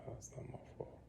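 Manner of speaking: quick, whispered protest under the breath; barely audible, hurried mutter; muttered denial. Real, not acted. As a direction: deny it in a fast, hushed whisper. Denies blame under his breath.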